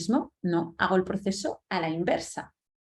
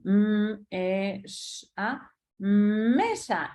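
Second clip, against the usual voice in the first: put-on child sounding out; 3 semitones higher, much slower than usual. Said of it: "es lo que hacen".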